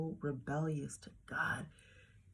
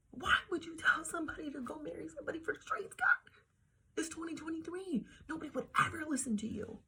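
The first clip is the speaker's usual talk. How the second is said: incredulous; 12 semitones higher, faster than usual. Real, not acted. Ranting at God, high pitched disbelief.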